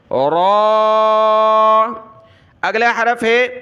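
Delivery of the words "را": "را" heavy, rounded and sustained; thick, open-mouthed. Shown as a demonstration. Teacher repeats heavy letter, "را" thick and long. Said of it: "منہ کھلا رہنا چاہیے".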